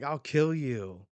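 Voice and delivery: loud, slow acted threat; angry threat. Silver Axe fighting Shiki, angry threat.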